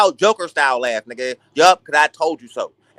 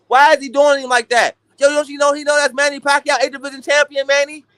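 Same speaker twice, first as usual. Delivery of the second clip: ordinary talk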